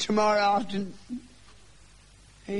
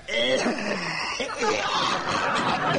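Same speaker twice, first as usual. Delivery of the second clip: hacking cough; 3 semitones higher, much slower than usual. Acted. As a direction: show him a real cough. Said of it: "You call that a cough?".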